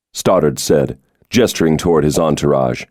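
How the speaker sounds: ordinary talk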